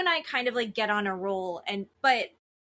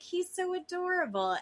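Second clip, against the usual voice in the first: higher-pitched, lightly mocked insincere praise; unconvinced gushing; 7 semitones higher than usual. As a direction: imitate someone gushing without really believing it. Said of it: "doesn't even really believe what she's saying".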